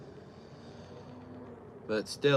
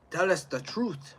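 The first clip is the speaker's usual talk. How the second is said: lightly voiced Russian interrogator, higher and slow; a hint of a stern interrogator demanding the truth; mock Russian interrogator; 7 semitones higher, slower than usual. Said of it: "they're still saying".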